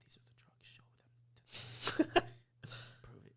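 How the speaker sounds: slow, unvoiced secretive whisper; hushed mock-sinister whisper. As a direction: whisper it slowly into her ear. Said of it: "I whisper to her".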